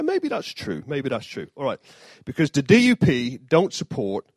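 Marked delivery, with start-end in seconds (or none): 0.0-0.8 mock agreement. Mock reply to own joke.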